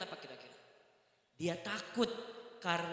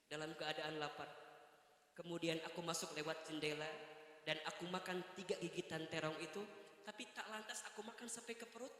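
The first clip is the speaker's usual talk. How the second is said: much softer, low and earnest; husband's hushed honest confession; 4 semitones lower, 12 dB quieter than usual. Retold or imitated. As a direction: confess gently to his wife.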